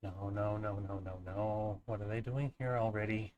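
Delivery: soft, low, dismayed muttering; quiet dismay. Acted. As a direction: groan it under your breath.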